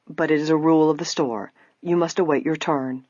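plain reading voice

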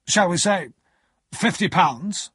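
imposing, deliberate lawyer's question; stern lawyer. Acted. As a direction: propose it slowly, in a stern character voice.

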